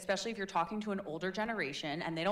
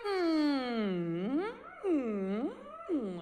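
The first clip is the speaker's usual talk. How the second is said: hmm hmm hmm humming; 9 semitones higher, much slower than usual. Shown as a demonstration. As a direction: hum gently three times. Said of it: "just me going".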